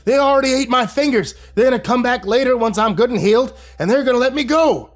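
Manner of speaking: high, loud spiteful rant; bitter old captive ranting; vicious old man rant. A narrator's reading. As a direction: rant at the rescuers.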